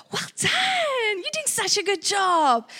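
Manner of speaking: put-on high, sing-song praise for a toddler; praising. Staff praising a little girl, sing-song. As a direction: gush like praising a little child. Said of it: "we go".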